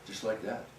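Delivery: ordinary delivery, nothing marked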